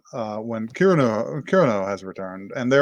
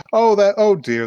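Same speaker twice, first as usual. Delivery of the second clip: higher-pitched mock mom voice; disapproving mom; 9 semitones higher, 4 dB louder than usual. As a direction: play a lightly voiced, fretting mother. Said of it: "His mom is just like".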